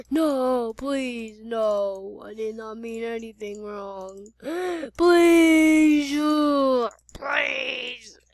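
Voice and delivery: long, wailing plea; acted sobbing desperation; tearful begging. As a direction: beg for mercy, stretching the words. Victim begs for life.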